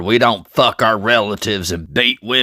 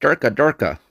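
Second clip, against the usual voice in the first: mock gibberish; 5 semitones higher than usual. Mock gibberish reply to an oath.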